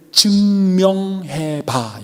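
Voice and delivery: very slow, every syllable stretched; weighty charge. Acted. Jesus charging disciples to prove his love, very slow. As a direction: draw it right out as a solemn challenge.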